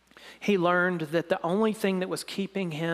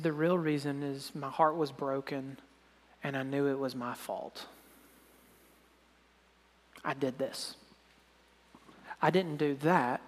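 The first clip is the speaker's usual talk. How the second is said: choked up; 3 semitones lower, 6 dB quieter than usual. Real, not acted. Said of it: "I, I couldn't say anything".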